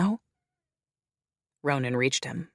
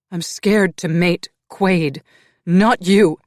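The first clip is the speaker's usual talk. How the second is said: loud, high and slow; snapped; 5 semitones higher, 10 dB louder, slower than usual. A narrator's reading. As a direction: bite it out at him. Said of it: "she snapped".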